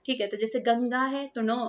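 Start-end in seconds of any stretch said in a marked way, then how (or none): 0.6-1.0 गंगा said slowly, a nasal ŋ running into गा; slow model word. Shown as a demonstration. Teacher demonstrating how गंगा is pronounced, slow and clear. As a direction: draw out गंगा as gaŋ-gaa with the nasal clear. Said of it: "इस तरीके से pronounce हुआ".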